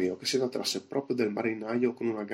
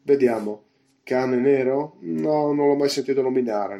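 louder, higher musing; raised voice, pondering; speaking aloud; 3 semitones higher, 6 dB louder than usual. A narrator's reading. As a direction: think out loud for all to hear. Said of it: "riprese ad alta voce".

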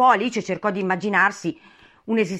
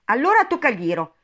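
raised, high call; 7 semitones higher, 6 dB louder than usual. A narrator's reading. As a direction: shout it to rouse a sleeper. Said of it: "gridarono gli altri due". (gridato, con voce alta)

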